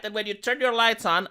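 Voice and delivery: ordinary delivery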